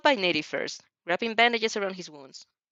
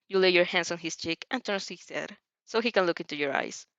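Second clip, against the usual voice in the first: ordinary delivery, nothing marked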